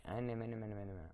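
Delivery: soft mumbled nonsense syllables; imitating scared gibberish. Sister imitating her scared brother, mumbled tongues. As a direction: lightly imitate a scared brother babbling under his breath. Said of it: "he will talk another language".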